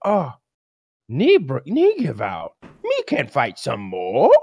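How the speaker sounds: mocking Anderson Silva's broken English after his knee injury. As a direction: slowly mimic a fighter's broken English. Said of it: "With that awful fucking broken English he's got".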